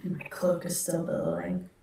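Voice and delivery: whisper. Real, not acted. Whispered elegant aside.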